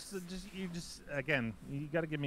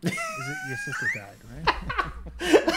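squealing with laughter; laughing high voice; 7 dB louder than usual. Pretend. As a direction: laugh through the words. Medium act, laughing.

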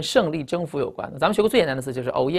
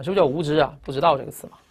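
ordinary talk, nothing marked

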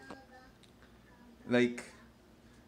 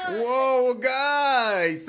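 loud, high, drawn-out exclamation; wowed and much higher-pitched; impressed whoa; 10 semitones higher, 7 dB louder than usual. Real, not acted. Admiring the kids' work in progress.

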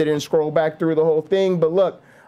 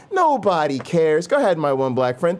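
usual speaking voice

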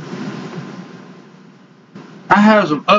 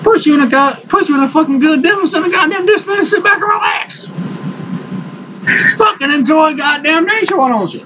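higher, fast, shouted rant; 9 semitones higher than usual. Real, not acted. To his subscribers about hunting, a fast ranting shout. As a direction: yell at them to get out in the deer stand.

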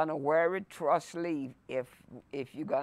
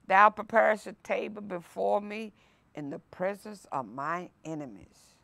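ordinary delivery

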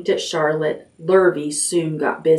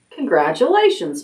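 usual speaking voice

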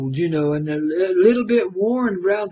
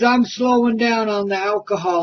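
ordinary talk, nothing marked

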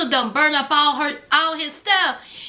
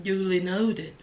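soft low repeat of "Duly noted"; quiet echoed aside; deadpan again softly; 9 semitones lower, 7 dB quieter than usual. Retold or imitated. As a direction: repeat it under the breath. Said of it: "I was like".

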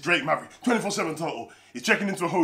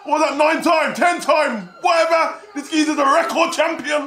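loud, high hyped-up holler; excited shouting; 9 semitones higher, 9 dB louder than usual. Real, not acted. Streamer celebrating a record champion, excited shouting.

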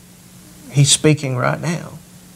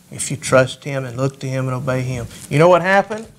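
ordinary talk, nothing marked

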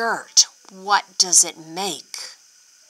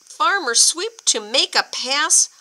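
ordinary delivery

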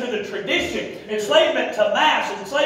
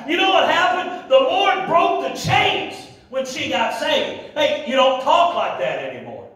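high, emphatic pulpit exhortation; fiery preaching; 5 semitones higher, 3 dB louder than usual. Real, not acted. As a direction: drive each phrase home to the congregation. Preaching at full force.

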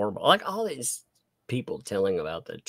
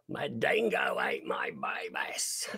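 slow, softer mock Aussie accent; terrible Australian accent; 7 semitones lower, 6 dB quieter than usual. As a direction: drawl it in a bad Australian accent. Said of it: "Mine's terrible".

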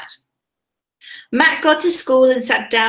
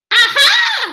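high, loud squawking Aha; seagull squawk; 14 semitones higher, 6 dB louder than usual. A narrator's reading. Seagull Solomon Shriek spotting fish, squawking high. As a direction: squawk it like a greedy gull. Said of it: "squawked a seagull".

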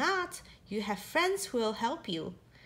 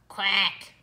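low, louder cross quack; angry duck quack; 5 semitones lower, 4 dB louder than usual. Pretend. Angry duck sound in book.

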